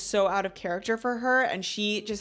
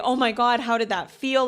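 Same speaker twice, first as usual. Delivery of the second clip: elated, retold; 4 semitones higher, 6 dB louder than usual. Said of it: "He is laughing".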